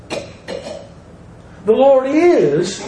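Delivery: "is" drawn out long and high; slow, high, emphatic "is"; stress on IS. Shown as a demonstration. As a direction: swell up on the word "is". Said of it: "wonderful thing about this word is".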